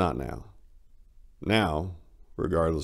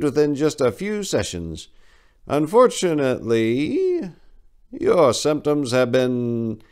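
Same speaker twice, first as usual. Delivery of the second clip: much higher and louder, slowing to the end; pompous doctor; 8 semitones higher, 5 dB louder than usual. Acted. Doctor lecturing the restrained patient, pompous and slow. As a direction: lecture him with prim, drawn-out concern.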